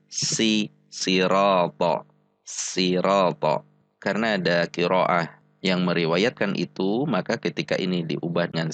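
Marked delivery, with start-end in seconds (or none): 0.1-3.6 sirata with a thin plain S; sin in sirata. Shown as a demonstration. Showing learners the letter changed to sin, light-S sirata. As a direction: stretch "sirata" slowly, opening on a light S. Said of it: "diubah dengan huruf sin".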